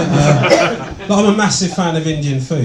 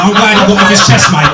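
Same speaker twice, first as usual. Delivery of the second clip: louder, blokey heckle from the crowd; cheeky mate impression; 5 semitones higher, 8 dB louder than usual. Applauding man calling out to the comic, loud and blokey. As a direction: shout it like a chuffed bloke. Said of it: "this guy started applauding".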